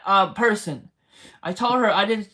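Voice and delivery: ordinary talk, nothing marked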